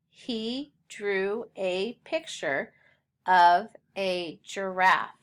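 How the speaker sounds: slow, word-by-word reading; measured reading with each word set apart; read-along pace. Shown as a demonstration. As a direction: read it slowly so a class can join in. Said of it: "Read it with me".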